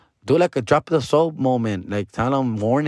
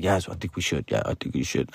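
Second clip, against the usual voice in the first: mock low voice; 8 semitones lower, 8 dB quieter than usual. Imitating the crew agreeing.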